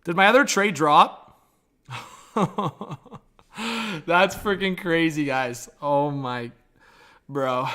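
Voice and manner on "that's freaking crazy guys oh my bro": laughing. Real, not acted. Laughing at the news.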